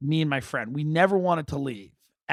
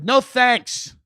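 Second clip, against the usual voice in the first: high, loud mock refusal; acted-out emphatic turndown; 8 semitones higher, 6 dB louder than usual. Friends turning down the outing.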